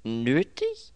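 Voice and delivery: lauter, hoch fragend; ungläubig nachfragen; verwundert. A narrator's reading. Verwunderte Rückfrage Anthonys. (verwunderte Rückfrage)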